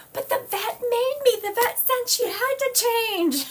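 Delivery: high-pitched imitation of a worried owner blaming the vet; flustered owner. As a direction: squeak out the owner's excuse.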